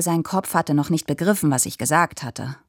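ordinary delivery, nothing marked

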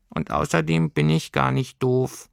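high squeaky creature lecturing on grammar; a tiny furry pedant explaining the genitive; high creature voice, smug and fussy; little know-it-all creature. Acted. Heimweh creature voice.

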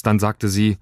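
usual speaking voice

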